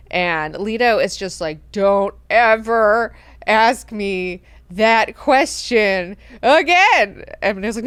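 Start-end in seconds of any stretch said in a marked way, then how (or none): 1.7-7.2 very slow, loud, word by word; acted furious warning; menacing warning. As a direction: space out each word, rising on the last. Lord threatens servant, very slow.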